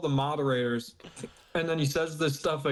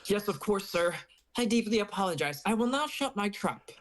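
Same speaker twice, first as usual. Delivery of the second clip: higher, submissive mock apology; playfully obedient, raised voice; meek apologizer; 5 semitones higher than usual. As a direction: act out a cowed underling. Acting out a meek apologizing member.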